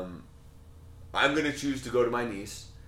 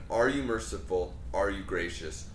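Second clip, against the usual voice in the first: ordinary delivery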